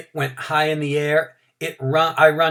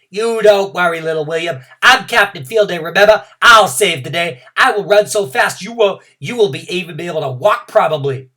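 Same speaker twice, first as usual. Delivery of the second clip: louder cocky comfort; boastful reassurance; 5 semitones higher, 10 dB louder than usual. Acted. Junie B reassuring little William, loud and cocky. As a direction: puff up and promise to save the day.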